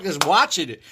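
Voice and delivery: ordinary talk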